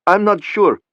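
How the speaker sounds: high, shaky, frightened voice. A narrator's reading. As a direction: let the voice tremble with fear. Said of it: "answered in a shaky voice".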